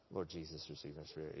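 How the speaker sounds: low, hushed last prayer; mock dying prayer. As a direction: pray softly as if it's all over. Mock last prayer, expecting death.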